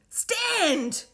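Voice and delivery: high, loud order to stand; commanding shout. A narrator's reading. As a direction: command the crowd to rise. Order repeated.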